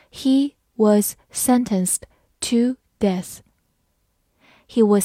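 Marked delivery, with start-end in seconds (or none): 0.1-3.4 slow, careful read-through. Shown as a demonstration. Teacher reads the sentence slowly for learners, slow. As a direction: say it slowly word by word. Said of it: "好，慢慢来读".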